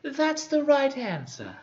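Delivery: very high, slow hag voice; Cockney hag. Acted. Hag approves the knight's answer, slow high Cockney hag voice. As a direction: draw it out high like a pleased crone. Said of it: "That's how she would talk".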